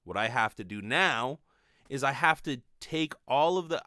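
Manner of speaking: normal talking voice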